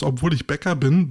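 ordinary talk, nothing marked

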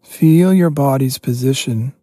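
normal talking voice

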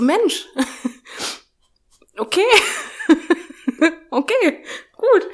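can't hold back laughter at the absurdity; giggly stunned okay; laughing disbelief. Real, not acted.

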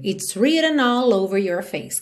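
usual speaking voice

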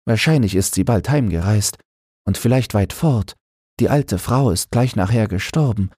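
Annemarie telling. Acted. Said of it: "sagte entschuldigend Annemarie". (Annemarie, höhere Frauenstimme, ruhig erzählend)

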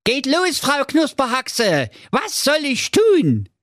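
put-on high-pitched fretful young prince; a pampered prince in a panic; whiny high prince. Prince eager. (hohe, weinerliche Prinzenstimme)

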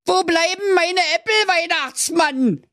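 put-on whiny child calling out; Hänsel calling. A narrator's reading. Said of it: "rief das Hänsel".

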